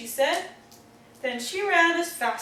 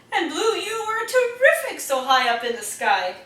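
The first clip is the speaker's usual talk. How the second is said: laughing praise; 5 semitones higher, 6 dB louder than usual. A narrator's reading.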